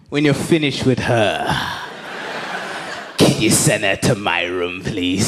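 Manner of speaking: hammed-up creepy father at the door; creepy dad. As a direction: drop deep for a seedy request. Dad outside the bedroom door.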